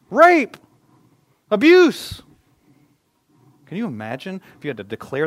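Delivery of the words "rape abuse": loud high anguished shouts; yelling out. Retold or imitated. Imagined person yelling their pain, loud high shouts. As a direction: cry each word out like a warning. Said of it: "you had to yell out your greatest pain".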